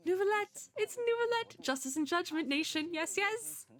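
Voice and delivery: high, eager naming; light up on the name; excited recognition. Real, not acted. Fan recognizing Neuvillette, high and excited.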